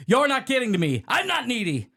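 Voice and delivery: high-pitched, insistent protest; wounded, angry denial; acted defensive outburst. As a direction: snap it back, pitched high. Acts out angry monologue.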